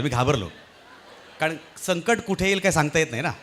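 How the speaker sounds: ordinary talk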